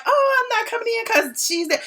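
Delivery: high-pitched mock refusal; mocking imitation. Mocking a guest who refuses to join the party, high voice. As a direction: imitate someone being petty, voice pitched high. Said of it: "How are you gonna be like".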